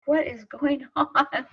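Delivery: high, slow, incredulous question; retold bewilderment. Woman after a roar off the mountain, retold bewilderment. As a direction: stretch it out high, half laughing in disbelief.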